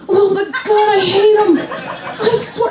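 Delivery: high-pitched comic exasperation; hammed-up neighbour-hating outburst; exasperated rant. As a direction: vent your hatred in a high strained voice. Ranting about hated neighbours.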